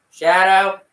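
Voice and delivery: high, loud call of a name; calling the dog. Real, not acted. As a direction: call across the room for the dog.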